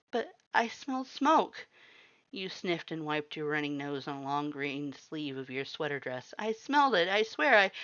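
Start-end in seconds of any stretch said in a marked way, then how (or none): 0.1-1.7 high, pleading protest; frightened girl arguing with the skeletons; upset insistence. A narrator's reading. 6.4-7.7 high, pleading protest; frightened girl arguing with the skeletons; upset insistence. A narrator's reading.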